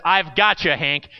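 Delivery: ordinary delivery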